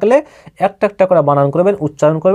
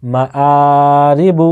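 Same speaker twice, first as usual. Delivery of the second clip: আ held long, then short রি বু; drawn-out vowel. Shown as a demonstration. Teacher showing khara zabar, the আ drawn out. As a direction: stretch the আ, keep মা, রি and বু short. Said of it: "টানতে হবে।".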